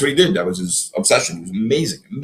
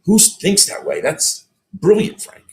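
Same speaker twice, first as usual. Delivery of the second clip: impressed praise; 9 semitones higher, slower than usual. Retold or imitated. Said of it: "He said".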